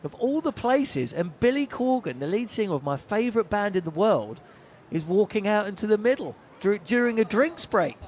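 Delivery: can't believe your luck; amazed disbelief. Retold or imitated. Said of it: "And I'm thinking".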